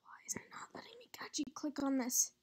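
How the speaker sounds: breathy whispered muttering. Real, not acted. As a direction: whisper it to yourself. Muttering at the screen.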